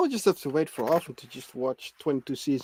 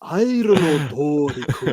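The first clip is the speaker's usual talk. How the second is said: slow, drawn-out character voice; put-on cartoon voice; much slower than usual. Co-host teasing the host, hammed-up character voice. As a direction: stretch it out like a voice actor. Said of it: "You gotta go into voice acting".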